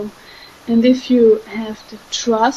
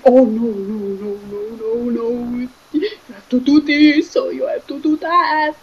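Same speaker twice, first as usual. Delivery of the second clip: a hint of panicky fear in the nos; mock fear. Fear pushing the speaker, mild mock panic. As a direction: lightly act out the worried voice. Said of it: "And then comes the fear".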